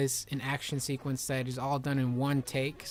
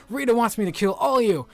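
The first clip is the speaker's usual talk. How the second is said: high, loud hammed-up threat; shouted kid-show menace; mock villain threat; 8 semitones higher, 8 dB louder than usual. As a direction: announce it like a cartoon baddie. Power Ranger threatens in story.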